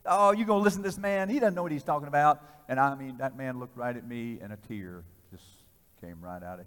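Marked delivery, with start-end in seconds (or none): 0.1-2.4 high, loud dismissal; acted-out derisive protest; scoffing friends. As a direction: wave him off loudly as a scoffing onlooker. Friends scoffing, stepping back.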